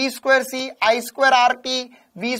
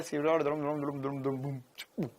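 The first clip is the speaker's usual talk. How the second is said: humming dum dum; 8 semitones lower, 13 dB quieter than usual. Acted. Humming while writing.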